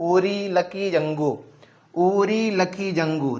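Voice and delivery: unfamiliar name drawn out slowly; slow word teaching. Shown as a demonstration. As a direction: say it slowly so the class can follow. Teacher repeats unfamiliar name for class. (अनजान शब्द धीरे-धीरे दोहराते हुए)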